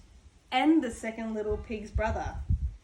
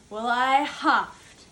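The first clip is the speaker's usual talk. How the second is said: slow, drawn out and building louder and higher; building huff to sneeze; 4 semitones higher, 6 dB louder, much slower than usual. Acted. Wolf winding up a sneeze at the straw house, slow and swelling. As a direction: stretch each word and swell into the sneeze.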